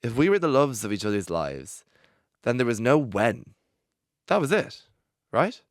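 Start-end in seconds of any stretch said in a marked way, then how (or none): 4.3-5.6 pitched way up in disbelief; questioning, voice climbing; high, disbelieving. Real, not acted. Hurt rhetorical question.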